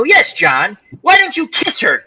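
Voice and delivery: high sing-song needling of his buddy; mock teasing. Teasing his friend John to kiss the drunk girl, sing-song and high. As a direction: egg him on with a grin.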